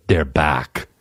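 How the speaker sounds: put-on low lightly done Austrian Terminator accent; a hint of Arnold's Austrian drawl; Schwarzenegger accent. Mocking Terminator line, light Austrian accent. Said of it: "he's gonna say".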